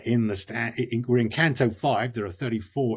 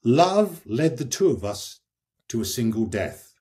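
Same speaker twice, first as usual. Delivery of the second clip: quiet verse reading; 3 semitones lower than usual. Shown as a demonstration. Reader quoting Francesca's lines to the listener, low and grave. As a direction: read it gravely and understated. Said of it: "She says".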